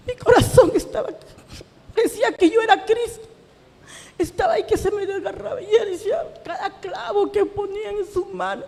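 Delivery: high, tearful voice, overcome; anguished testimony. Real, not acted. Believer recalling her daughter describing the nails, real anguish. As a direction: tell it through welling tears, pitched high.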